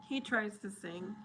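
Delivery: usual speaking voice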